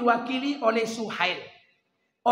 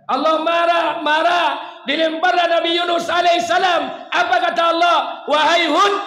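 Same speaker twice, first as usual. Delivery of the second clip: booming angry shout, much higher and louder; 8 semitones higher, 12 dB louder than usual. Acted. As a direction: thunder out God's fury. Preacher shouting God's anger. (teriakan marah yang menggelegar)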